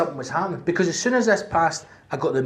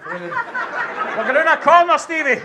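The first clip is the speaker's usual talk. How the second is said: high, slow, louder warning; mock paramedic warning; 9 semitones higher, 4 dB louder, slower than usual. To partner Stevie, high slow warning. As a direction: warn your partner, drawn out high.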